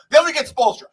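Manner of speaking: usual speaking voice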